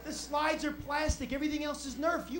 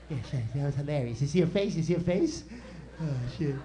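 low-pitched gloating wiseguy; low tough-guy kid, savouring the prank; Joe Pesci; 11 semitones lower than usual. Retold or imitated. Brother ducking and gloating in the car, Joe Pesci voice. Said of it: "He talked like Joe Pesci since I was seven".

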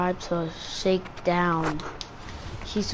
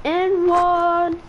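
excited shout; 8 semitones higher, 5 dB louder than usual. Real, not acted. Celebrating a scored basket.

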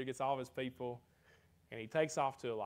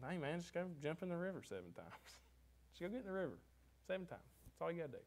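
casual dismissive; 5 semitones higher, 7 dB quieter, faster than usual. Retold or imitated. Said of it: "instead it was just".

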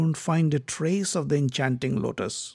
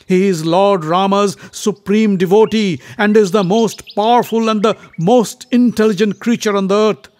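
indignant; 5 semitones higher, 8 dB louder than usual. Acted. Bhima indignant at the monkey.

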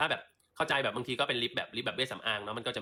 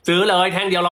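teasing exclaim; 7 semitones higher, 16 dB louder than usual. Real, not acted. Teasing sister about freebies.

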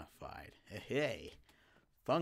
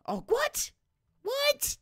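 very high, loud disbelieving yelp; shocked; 6 dB louder, much slower than usual. Real, not acted. Teammate leaves the game, very high shocked yelp. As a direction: squeal in disbelief.

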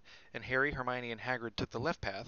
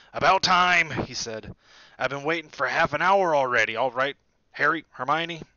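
loud, higher, hearty giant; booming Hagrid; 4 semitones higher, 12 dB louder than usual. A narrator's reading. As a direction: call out warmly across the lawn. Hagrid's big voice.

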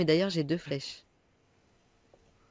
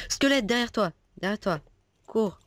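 urgent warning; 8 semitones higher than usual. Real, not acted. Warning teammate of a skeleton.

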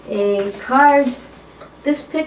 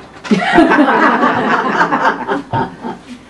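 big nervous burst of laughter; hearty laugh; 4 semitones higher, 6 dB louder than usual. Real, not acted. Nervous reader breaks up before a sexy poem, hearty laugh. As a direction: let a loud laugh spill out.

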